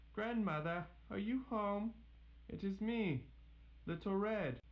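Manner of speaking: high, called through the door; Little Red calls through the door, high and clear; calling out. Acted. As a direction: call to grandmother inside.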